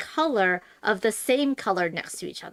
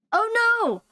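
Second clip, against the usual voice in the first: higher, louder cry of dismay; 13 semitones higher, 5 dB louder than usual. Real, not acted. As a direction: gasp it out on realizing a mistake. Realizes misplaced tile.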